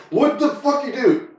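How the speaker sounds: high outraged yell; mock outraged yell at the TV. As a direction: shout at the screen in disbelief. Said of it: "I was yelling at my T V".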